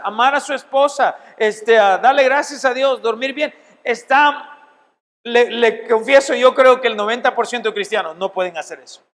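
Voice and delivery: usual speaking voice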